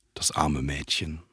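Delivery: soft, slow murmur, still voiced; a hint of a whisper, slow and hushed; understated whisper. A narrator's reading. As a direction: say it slowly under your breath, lightly whispered. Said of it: "flüsterte ich".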